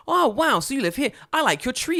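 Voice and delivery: high, loud imitated child; little girl's voice. Little girl admiring the neighbour's tree, high excited kid voice. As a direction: gush like an impressed kid. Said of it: "She's like".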